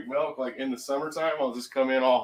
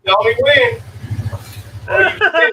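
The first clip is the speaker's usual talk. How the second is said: loud call over a running engine; shouting over engine; 12 semitones higher, 12 dB louder than usual. Real, not acted. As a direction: yell it so the driver hears. Yelling to driver over engine.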